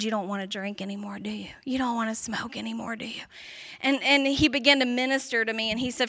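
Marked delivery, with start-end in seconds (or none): 0.0-3.2 gentle pastor. Retold or imitated.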